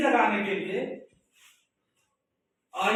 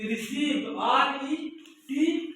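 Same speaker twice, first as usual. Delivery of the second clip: slow spelling; 2 semitones higher, much slower than usual. Shown as a demonstration. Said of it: "ये trick समझिए".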